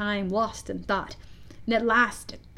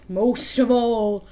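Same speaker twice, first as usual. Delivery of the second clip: slow, drawn-out villain's passion; passionate; 4 dB louder, much slower than usual. A narrator's reading. Hook naming the enemy he wants most, slow and passionate. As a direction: savour every word with intensity. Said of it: "Hook said passionately".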